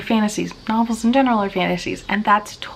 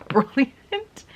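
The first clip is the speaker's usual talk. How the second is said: Brilliant? said much higher, as a disbelieving question; incredulous echo; 5 semitones higher than usual. Real, not acted. Reviewer echoing the word brilliant in amazement, incredulous.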